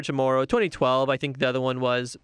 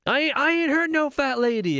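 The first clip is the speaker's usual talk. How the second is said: much higher, hammed-up action-hero line reading; Will Smith quote; 12 semitones higher than usual. Fan reciting favorite lines, hammed-up Will Smith voice. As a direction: toss off the line like a cocky movie hero. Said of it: "there's so many great Will Smith lines".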